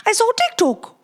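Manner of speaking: high-pitched mock Italian accent; sing-song attempt at an Italian accent; put-on accent. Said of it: "I'm stuck in that dialect".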